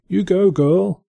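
high-pitched mock cheer; high, playful voice of an encouraging angel. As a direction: cheer her on in a high put-on voice.

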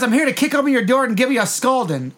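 high-pitched retold threat; mock yelling. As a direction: announce it like a half-hearted angry shout. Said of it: "He wants to yell at him".

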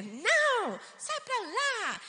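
high, loud, drawn-out rebuff; toddler's refusal. Retold or imitated. Little sister refusing to play with sister, cross.

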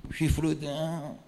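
lightly acted low, drawn-out taunt; Michael addressing the devil. Michael sizing up the devil, a hint of low, slow mockery. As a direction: stretch the name out low, as a mock challenge.

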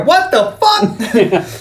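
high, drawn-out cry of disbelief; shocked outcry. Retold or imitated. Gang spotting the man in a retold movie, shocked outcry. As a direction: mimic a stunned yell, stretched out long. Retells shocked gang's shout.

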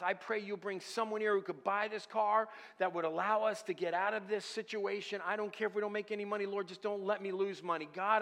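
urgent, impassioned petition; passionate prayer. Retold or imitated. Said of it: "cry out with great passion".

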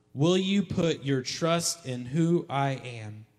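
slow, each word laid down; slow question. Acted. Preacher asking congregation to trust, slow. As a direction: draw the question out slowly.

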